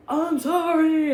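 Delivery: drawn-out, high, loud mock sorry; mock apology. Imitating her boyfriend caught texting girls, mock sorry.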